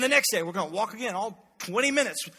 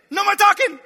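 much higher, louder furious shout; parent yelling; 10 semitones higher, 9 dB louder than usual. Retold or imitated. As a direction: snap at the kids in the back seat. Parent yelling at kids in car.

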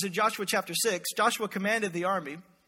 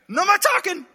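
much higher, louder sharp command; Joshua shouting; 9 semitones higher, 6 dB louder than usual. Retold or imitated. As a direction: cut the tuba player off hard. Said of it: "'Cause Joshua knew him".

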